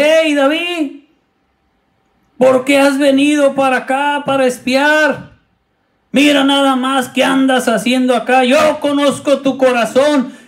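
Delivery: put-on high, accusing older-brother scolding; older brother scolding David, high and annoyed. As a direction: put him in his place, suspicious.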